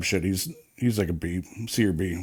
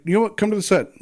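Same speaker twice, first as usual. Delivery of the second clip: high, bright imitation of the producer's wife; 12 semitones higher, faster than usual. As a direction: pitch way up to play the kind wife. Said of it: "And then she's like".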